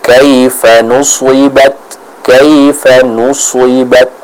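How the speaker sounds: slow recitation of kaifa nusibat with heavy, emphatic S; slow model reading, thick sad in nusibat; thick shad. Shown as a demonstration. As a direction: stretch kaifa nusibat, the S full and thick as "swi". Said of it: "membacanya dengan ketebalan yang sempurna".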